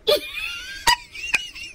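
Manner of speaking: very high-pitched laughing fit. Real, not acted. Host losing composure mid tutorial, laughing. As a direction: break up laughing helplessly. Host breaks up during video.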